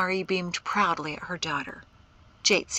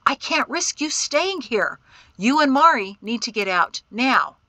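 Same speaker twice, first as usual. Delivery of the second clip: tense, raised urgency; louder and higher, voice tightening; 5 semitones higher, 8 dB louder than usual. A narrator's reading. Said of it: "his voice growing tighter".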